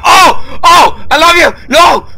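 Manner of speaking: loud high panicked cries; frightened shrieks. Real, not acted. Gamer shrieking at a figure in the dark.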